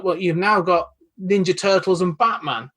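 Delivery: ordinary talk, nothing marked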